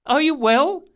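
high, loud anxious question; concerned boy. Acted. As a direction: fuss over them in a high voice.

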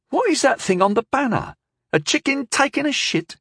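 high-pitched, puzzled questioning; bemused high character voice. A narrator's reading. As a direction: ask it in baffled disbelief, pitched well up.

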